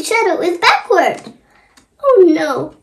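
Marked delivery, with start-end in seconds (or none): none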